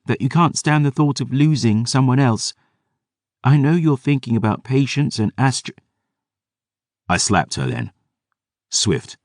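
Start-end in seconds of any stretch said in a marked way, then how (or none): 0.0-5.8 put-on tearful girl. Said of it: "she said".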